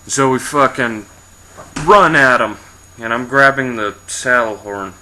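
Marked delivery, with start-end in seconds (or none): 0.1-2.6 loud, raised burst of action; charging burst. A narrator's reading. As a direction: punch it out as if launching a charge. Narrates the charge at the troll.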